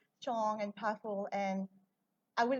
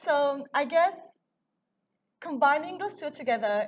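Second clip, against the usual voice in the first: teary, pitched higher and louder; after crying; 6 semitones higher, 9 dB louder than usual. Real, not acted. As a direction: push on through fresh tears. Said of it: "I'm getting a bit teary".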